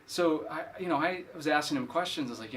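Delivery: ordinary delivery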